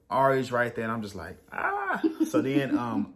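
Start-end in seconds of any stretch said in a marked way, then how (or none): 1.5-2.0 overwhelmed exclamation. Retold or imitated. Overwhelmed by phones and baby.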